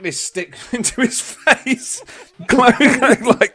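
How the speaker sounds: words broken by laughter; high giggling delivery; laughing. Real, not acted. Laughs mid-story.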